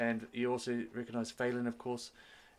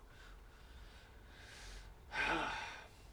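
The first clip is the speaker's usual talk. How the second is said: long, breathed-out sigh of frustration; exasperated sigh; 5 semitones higher, 7 dB quieter than usual. Acted. Frustrated prince after bad news.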